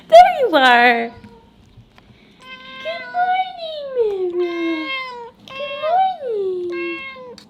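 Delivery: very high, slow cooing. Real, not acted. Cooing to the cat.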